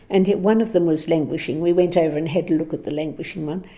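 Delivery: ordinary talk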